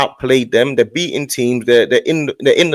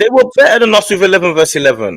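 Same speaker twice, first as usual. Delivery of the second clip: louder, higher argumentative push; heated rebuttal; 6 semitones higher, 5 dB louder than usual. Real, not acted. Fan disputing the score argument with his debate partner, heated. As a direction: contradict forcefully, raised voice.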